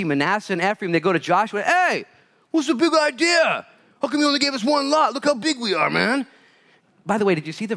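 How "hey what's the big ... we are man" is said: high, loud mocking voice of spoiled sons; whiny entitled brats. Preacher mocks Ephraim's sons demanding land from Joshua, whiny. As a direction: whine it like a spoiled kid demanding more.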